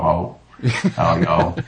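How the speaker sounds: lazy, laid-back Southern drawl; easy Southern talk. Shown as a demonstration. Showing how easy Southern is, laid-back Southern accent. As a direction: slip loosely into a Southern greeting. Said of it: "Just slip into Southern talk".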